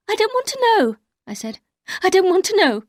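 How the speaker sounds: very high, pained cry; distraught refusal. Acted. Narrator cutting off Danvers, high and distraught. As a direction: refuse to hear, near tears.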